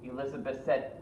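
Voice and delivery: ordinary delivery, nothing marked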